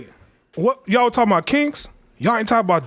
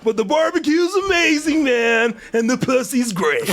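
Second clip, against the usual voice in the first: higher, laid-back bro voice; mock Sammy impression, higher voice; 4 semitones higher than usual. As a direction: play him as a chill California dude, pitched up. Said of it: "This is his impression".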